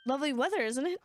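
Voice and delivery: put-on tentative British accent; mild British accent, lightly done. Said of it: "I can do a British accent today".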